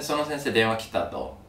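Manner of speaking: ordinary talk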